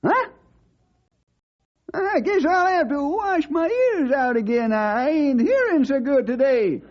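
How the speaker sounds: put-on high old cowhand voice, baffled; incredulous old sidekick. Sidekick reacting to Bill's swim plan, high and baffled. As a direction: act like your ears must be failing.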